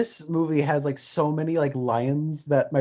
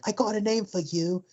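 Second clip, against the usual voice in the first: quoting the wife; 6 semitones higher than usual. Retold or imitated. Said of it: "and she's like".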